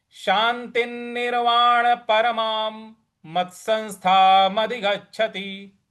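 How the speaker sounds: lifted chanting Sanskrit line; measured scripture chant; verse recitation. Acted.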